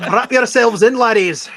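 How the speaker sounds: hammy Scottish brogue, loud; mock Scottish accent, playful. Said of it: "talk in a Scottish accent".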